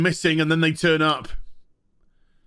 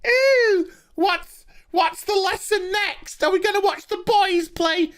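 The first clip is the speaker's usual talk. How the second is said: mocking sassy falsetto; 14 semitones higher than usual. Reviewer mocking the engineer's line in high sassy falsetto. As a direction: ham up a catty teen in falsetto. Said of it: "I'd give them a piece of my mind".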